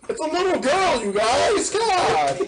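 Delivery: high laughing protest; laughing disbelief. Real, not acted. Horrified laugh about the joke.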